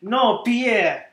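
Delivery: bad French director impression, slowed; terrible French accent. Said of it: "why am I doing fucking terrible French accent".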